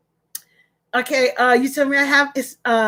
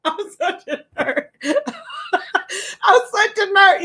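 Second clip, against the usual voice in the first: laughing; 5 semitones higher, much slower than usual. Real, not acted. Giggling fit on live stream.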